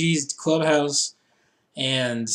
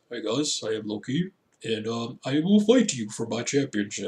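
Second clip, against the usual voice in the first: low, subdued trailer-narrator impression; movie trailer voice; 4 semitones lower, 4 dB quieter than usual. Imitating a wrestler challenging for a championship, movie trailer voice. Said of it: "it's like a movie trailer voice".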